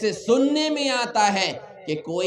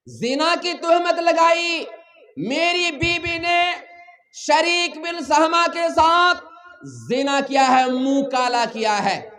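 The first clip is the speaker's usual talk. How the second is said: high, raised voice denouncing a wrong; 6 semitones higher than usual. Acted. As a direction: cry out the charge, pitched high. Acting out husband's public accusation.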